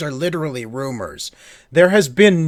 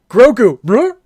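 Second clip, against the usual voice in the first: loud, much higher playful trill; Mando voice; 9 semitones higher, 9 dB louder than usual. Retold or imitated. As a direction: belt out the name with a rolling brr. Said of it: "he does it again".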